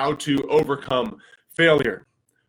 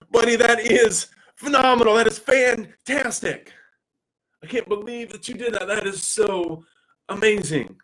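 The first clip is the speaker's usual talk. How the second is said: big, high, loud delighted praise for his son; an overjoyed dad cheering his son's Lego win; excited praise; 8 semitones higher, 4 dB louder than usual. Retold or imitated. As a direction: gush over the kid's achievement, slowing on fantastic. Dad praising son's finished robot.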